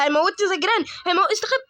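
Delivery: high, loud panicked cries; scared shouting to hide; panicked yelling. Real, not acted. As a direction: yell as it runs at you. Chased by the neighbor.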